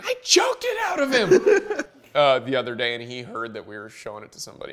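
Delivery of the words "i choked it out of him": put-on very high falsetto kid voice; imitated angry son. Son defending himself on mom's video, mock squeal. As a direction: squeal it like an outraged little boy. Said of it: "played the video of him saying".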